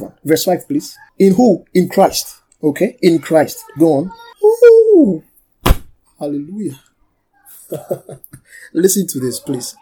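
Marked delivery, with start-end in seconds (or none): 4.4-5.2 high, loud "ooh"; thrilled whoop. Real, not acted. Preacher reacting to the verse, thrilled whoop. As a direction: let out a long, high whoop of delight.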